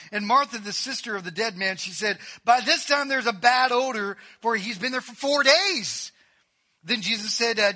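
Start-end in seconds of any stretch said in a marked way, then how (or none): 2.4-6.1 high, loud acted objection; protesting. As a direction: object as if it's absurd, voice raised. Martha protesting about the smell.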